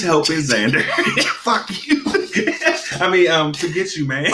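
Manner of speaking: high laughing. Real, not acted. Friend cracking up at his swearing, laughing.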